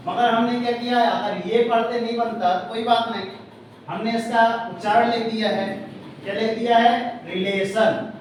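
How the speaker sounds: ordinary talk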